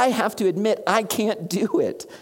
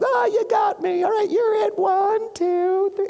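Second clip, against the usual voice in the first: playful caught-out Jesus. Retold or imitated. Jesus caught in hide and seek, high gleeful counting. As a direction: give up the hiding spot like a gleeful kid, then count it off. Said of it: "And Jesus is like".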